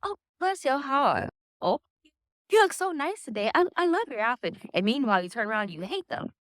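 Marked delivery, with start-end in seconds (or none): 0.0-4.6 fake-sweet pleasantries. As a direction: gush the fake compliments up high. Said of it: "Americans are very all about pleasantries".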